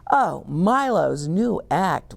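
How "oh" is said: ordinary delivery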